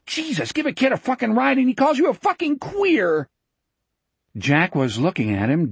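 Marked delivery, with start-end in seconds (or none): 0.0-3.3 high-pitched furious screech; shrill, offended screaming, pitched way up; outraged scream. A narrator's reading. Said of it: "he screamed".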